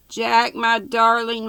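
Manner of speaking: high, loud mock-heroine gush; melodrama maiden. A narrator's reading. Ermengarde accepts Jack's proposal, high and gushing mock rapture. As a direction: declare love in hammed-up rapture.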